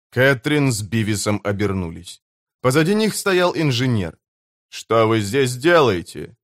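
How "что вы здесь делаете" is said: plain reading voice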